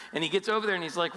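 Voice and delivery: ordinary delivery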